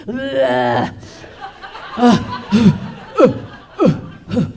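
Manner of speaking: low, heavy caveman "uh"s; caveman grunts. Acted. Man hunched forward like a caveman, grunting. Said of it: "Like a caveman".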